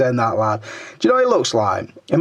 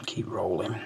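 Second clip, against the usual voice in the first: whisper; 5 semitones lower, 13 dB quieter than usual. Real, not acted. Muttering while scrolling.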